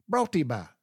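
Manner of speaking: "Brought to you by" squeezed into brought-tee-by; one-word slogan. Retold or imitated. Repeating the commercial as he misheard it, run together. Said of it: "It was just a word".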